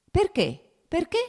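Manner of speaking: two high, loud, urgent questions; insistent questioning. A narrator's reading. Nikolai cutting in on his hesitant brother, urgent demand.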